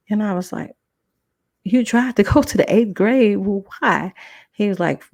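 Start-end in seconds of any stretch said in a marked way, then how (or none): 1.6-4.1 high, loud disbelief; incredulous. Retold or imitated. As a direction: call it out stunned. Shocked teacher tried to follow.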